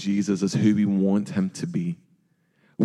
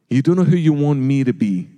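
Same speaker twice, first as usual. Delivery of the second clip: ordinary delivery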